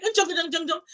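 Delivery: high-pitched mock Chinese syllables; imitated Chinese. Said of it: "who speaks Chinese like".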